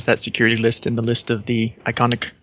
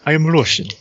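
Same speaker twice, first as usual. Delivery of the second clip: put-on proud, hammy Russian accent, a bit louder; 5 semitones higher, 3 dB louder than usual. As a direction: declare it in a heavy Russian accent. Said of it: "I know [name] does a Russian accent".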